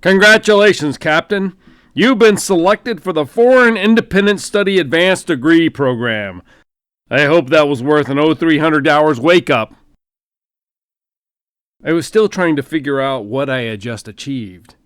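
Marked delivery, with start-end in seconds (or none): 0.0-9.8 loud, high booming voice of a general. Acted. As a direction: boom it down the phone line like a hearty general. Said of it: "the voice boomed".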